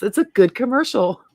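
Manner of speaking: ordinary talk, nothing marked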